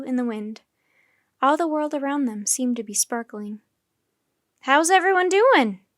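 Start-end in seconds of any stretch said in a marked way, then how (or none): 4.6-5.8 high, loud cheerful call; called over shoulder. A narrator's reading. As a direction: call back down the bus. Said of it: "Nancy called over her shoulder".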